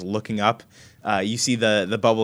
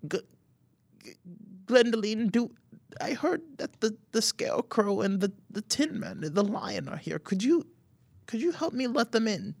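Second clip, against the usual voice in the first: put-on high frail old voice; old grandmother; 13 semitones higher, 4 dB quieter than usual. Grandmother asking Glendoline to open up, high frail voice. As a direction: plead gently like an aged grandma. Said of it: "she kind of sighs and she says".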